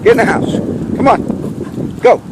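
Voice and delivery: high, loud firm command; ordering the dog. Real, not acted. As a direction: send the dog indoors now.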